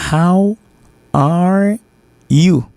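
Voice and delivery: HOW, ARE, YOU as three separate words with pauses. Shown as a demonstration. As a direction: say "How are you" one drawn-out word at a time. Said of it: "Ça fait trois mots".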